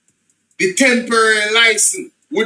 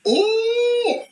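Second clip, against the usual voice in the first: oh jumps way up in pitch; a sudden high gasp of realization; surprised oh. Real, not acted.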